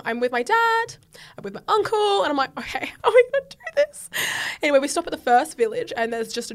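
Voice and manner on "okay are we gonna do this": laughing disbelief. Retold or imitated. Bracing herself, laughing.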